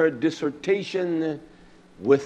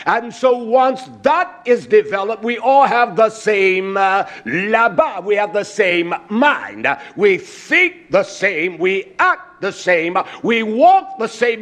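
raised, louder preaching voice; fervent preaching; 6 semitones higher, 4 dB louder than usual. Acted. Preacher exhorting the congregation, rising fervent sermon.

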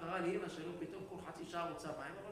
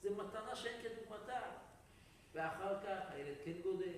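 ordinary delivery

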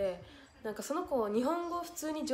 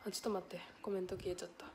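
breathed with no voice, much softer; whisper; 4 semitones lower, 10 dB quieter, much faster than usual. Real, not acted. Noticing the comment vanished, whisper. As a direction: whisper it to yourself.

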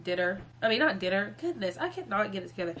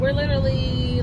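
ordinary talk, nothing marked

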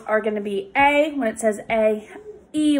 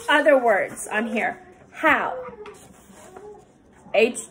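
ordinary talk

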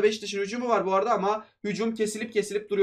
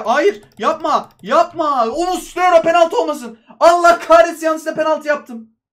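slow pleading "no", higher and much louder; desperate shout; 6 semitones higher, 8 dB louder, slower than usual. Real, not acted. Gamer begging his keeper not to foul, then cursing loudly. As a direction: cry out watching the penalty happen. Gives away a penalty.

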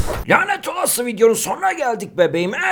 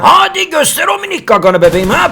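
taunting; 8 semitones higher, 9 dB louder than usual. Real, not acted. Taunting enemy in game.

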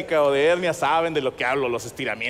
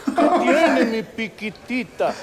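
put-on high cooing baby talk, drawn out slow; 7 semitones higher, 4 dB louder, much slower than usual. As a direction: coo it at her like to a toddler. Marido consiente a esposa. (voz de bebé, alta y lenta)

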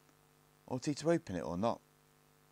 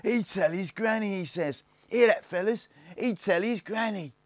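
high, loud jeering; cackling mockery; 13 semitones higher, 8 dB louder than usual. A narrator's reading. Snargets mock Barney's granny threat, high cackling jeer. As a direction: hoot it to your mates. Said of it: "They cackled".

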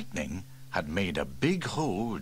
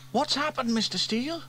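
put-on high, eager boy's voice; curious boy. Young James asks the fire chief what happened, eagerly. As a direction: ask like a worried little boy.

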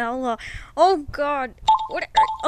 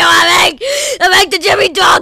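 furious shout; 4 semitones higher, 12 dB louder than usual. Acted. Furious threats at the toys.

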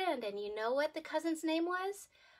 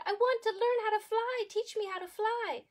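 eager plea; 8 semitones higher than usual. Acted. Monkey begs to fly.

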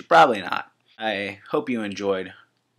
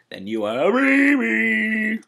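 high mocking voice; 11 semitones higher, 4 dB louder, slower than usual. Mocking viewer as a baby.

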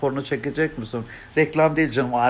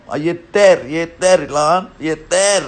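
loud, raised, drawn-out protest; exasperated outcry; 4 semitones higher, 6 dB louder, slower than usual. Acted. Fed up with lewd comments from viewers, exasperated loud outcry. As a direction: cry enough, stretching each repeat. Crying enough at pestering comments.

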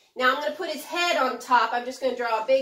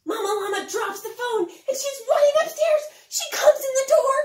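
high, loud, fast urgent storytelling; building alarm as she bursts in; frantic rush; 12 semitones higher, 6 dB louder than usual. A narrator's reading. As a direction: race through it like mama is running up the stairs. Mama Llama rushing upstairs in story.

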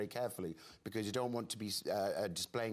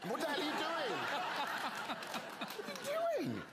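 self-scolding. Real, not acted. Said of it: "So embarrassing".

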